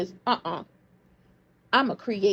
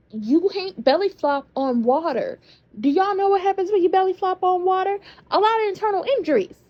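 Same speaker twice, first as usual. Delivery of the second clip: incredulous rant; 7 semitones higher, 4 dB louder than usual. Real, not acted.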